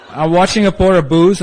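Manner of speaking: usual speaking voice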